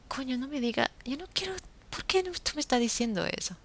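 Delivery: replaying her shocked retort to the neighbor; mock-outraged disbelief, fast and high; indignant outburst. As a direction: pitch jumps up, rushed and incredulous. Retells her own indignant reply.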